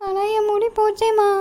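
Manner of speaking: put-on high, slow weepy little girl; crying child. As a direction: whimper over the lost hair. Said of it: "இதுக்கு ஏன் அவ்வளவு அழுவுற?".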